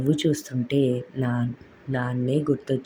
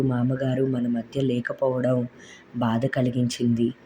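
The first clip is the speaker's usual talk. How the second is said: ordinary delivery, nothing marked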